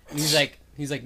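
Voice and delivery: ordinary talk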